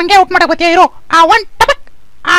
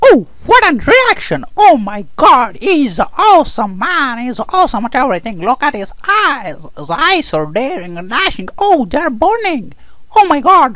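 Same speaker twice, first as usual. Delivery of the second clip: hyped commentary; 4 semitones lower than usual. Acted. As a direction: cheer every move like a crazed fan. Mock commentary on hero's fight. (ಅತಿ ಉತ್ಸಾಹದ commentary)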